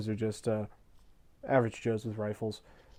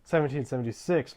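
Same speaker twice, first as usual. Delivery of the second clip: ordinary delivery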